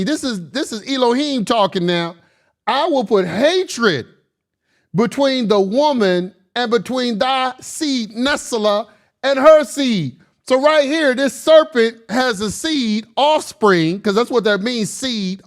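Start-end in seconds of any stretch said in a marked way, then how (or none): 2.7-10.2 solemn scripture reading. Acted.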